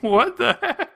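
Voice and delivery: high, baffled exclamation. Real, not acted. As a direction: react in disbelief. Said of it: "I'm just like".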